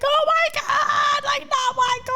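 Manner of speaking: high, drawn-out mock wail; retold crying. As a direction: re-enact a tearful, panicked wail. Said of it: "And I start crying".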